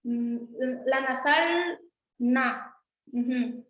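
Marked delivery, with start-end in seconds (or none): 0.9-2.7 "na" slowly with a dental n; "na" spoken slowly, teacher demo; nasal na demo. Shown as a demonstration. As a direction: say "na" slow and nasal. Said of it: "un ejemplo".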